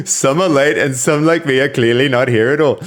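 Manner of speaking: reading aloud, loud and still laughing. Real, not acted. Reading a chatter's joke aloud while still laughing. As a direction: read it through laughter.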